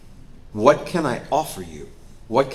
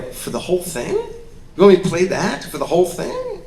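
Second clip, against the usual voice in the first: incredulous rising question, getting louder; surprised; 8 semitones higher, 5 dB louder than usual. Retold or imitated. Actor questioning his director's note, incredulous. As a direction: disbelief at the ask.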